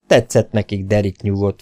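plain reading voice

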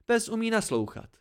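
high, heated, pointed comparison; cutting retort. A narrator's reading. Narrator compares Dena unfavourably with a dog, cutting. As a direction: drive the insult home, pitched high.

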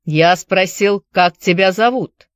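put-on firm repeated question, Irish accent; stern Irish accent. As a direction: press the question in an Irish brogue. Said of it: "с сильным ирландским акцентом".

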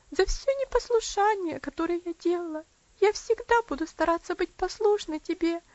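put-on quiet little girl. A narrator's reading. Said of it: "ответила она тихим".

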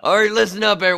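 loud, high, laughing coach bark. Pretend. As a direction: call the team in like a coach. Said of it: "This is my coach voice".

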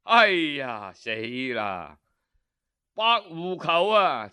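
plain reading voice

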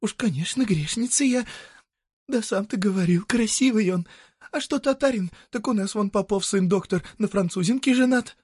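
high, animated woman justifying herself; defensive peasant woman. Acted. Woman defending her lover to a passer-by, high and insistent. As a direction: argue back in a high village-woman voice. Voices Marya in story.

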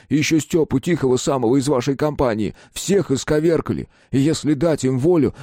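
urgent man pleading. Acted. Vykhin pleads.

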